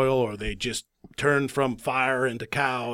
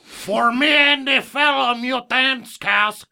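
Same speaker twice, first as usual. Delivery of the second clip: put-on high, loud, slow Fantasy Island accent; talking cow with a drawn-out, high Tattoo accent; Tattoo's accent; 9 semitones higher, 4 dB louder, slower than usual. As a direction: plead slowly in a hammed-up Fantasy Island accent. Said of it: "He had a little accent".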